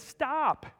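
loud, high-pitched command; forceful acted warning; pain's urgent warning. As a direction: cry it out as an alarm. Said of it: "Pain says".

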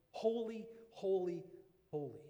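"Holy, holy, holy" in separate beats, loud at first, then falling; emphatic repetition. Retold or imitated. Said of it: "they're emphasizing this word".

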